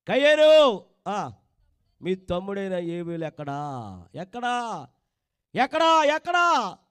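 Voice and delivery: a demanding God asking where, building up; God's voice. Acted. God questions Cain in story. (కయీనును ప్రశ్నిస్తున్న దేవుడు, గట్టిగా)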